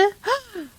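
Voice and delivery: high delighted gasp; quick gasp of delight; excited gasp. Real, not acted. Streamer gasping at incoming raiders, excited. Raid arrives.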